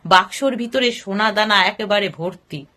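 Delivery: usual speaking voice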